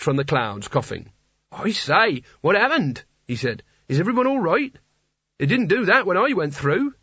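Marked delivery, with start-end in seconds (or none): 1.5-6.9 high, cheerful and puzzled; an innocent barbarian popping up; cheery young hero. Acted. Nigel emerges coughing.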